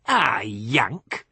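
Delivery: gruff local. A narrator's reading. Seth answering Billy Joe, gruff and belligerent. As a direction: grunt it back belligerently.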